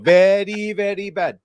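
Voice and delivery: hammed-up verdict in a comic Indian accent. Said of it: "I'll do that one, though".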